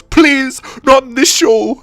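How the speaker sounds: high-pitched hammed-up plea; mock pleading. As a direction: beg in a high mock-victim voice. Acts a threatened victim pleading.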